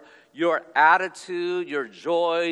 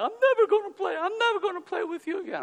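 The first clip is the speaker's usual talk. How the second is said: put-on high, wailing kid-brother voice; whiny little brother; faster than usual. Younger brother refusing to play, high whiny wail. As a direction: squeal it like a sulking younger brother. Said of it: "He goes".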